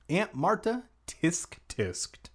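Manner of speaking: plain reading voice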